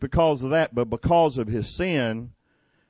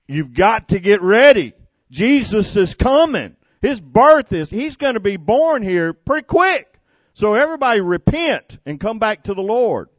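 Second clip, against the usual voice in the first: preaching shout; 7 semitones higher, 7 dB louder than usual. Acted. Preacher voicing John's proclamation.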